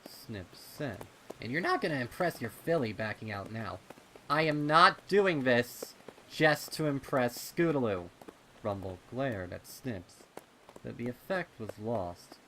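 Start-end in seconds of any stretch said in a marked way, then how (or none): 1.4-3.8 teasing colt. Acted. Snips teasing. 4.3-8.1 put-on high, very loud defensive kid; indignant colt. Rumble defensive, embarrassed.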